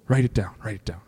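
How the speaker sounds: breathy whisper to a note-taker; whispered aside. Real, not acted. Pastor whispers to Sarah to write it down. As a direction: whisper it off to the side.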